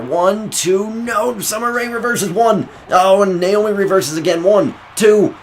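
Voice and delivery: high, loud ringside count; excited pin count. Acted. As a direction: count the pin like the crowd's on its feet. Near-fall pin count, rising excitement.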